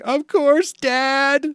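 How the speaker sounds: mock emotional cry, very high and loud; Ace. Ace answers his father's last question, imitated emotional cry. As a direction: call it out as a heartfelt hammed-up yell. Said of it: "that was really emotional".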